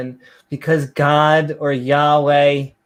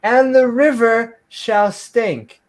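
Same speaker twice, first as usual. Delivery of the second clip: higher, slow scripture proclamation; hammy, solemn King James declamation; mock-biblical reading; 8 semitones higher, slower than usual. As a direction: intone the plague like grand scripture.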